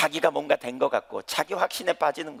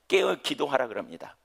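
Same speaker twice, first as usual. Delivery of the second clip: ordinary talk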